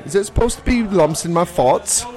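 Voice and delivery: high-pitched mock British child; British accent. Shown as a demonstration. Podcaster showing friends what British is like, high British accent. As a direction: ask Mommy in a hammed-up British accent. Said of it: "British is more like".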